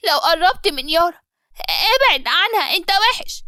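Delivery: put-on high child voice, sobbing out the words; a boy pleading through tears; crying child. Son Ali crying at his father, high and childlike. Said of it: "وفضل يعيط وقال".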